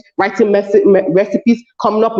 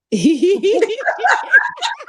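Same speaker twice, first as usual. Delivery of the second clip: laughter; 9 semitones higher, 4 dB quieter than usual. Real, not acted. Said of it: "okay, I'll stop".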